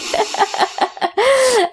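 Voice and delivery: high, helpless burst of laughter; high giggle. Real, not acted. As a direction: let a high laugh bubble out. Laughter after triplets news.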